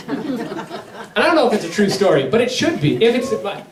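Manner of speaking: laughing, amused. Real, not acted. Preacher laughs at his own story.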